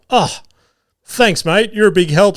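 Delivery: sarcastic thanks. Retold or imitated. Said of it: "And I've gone".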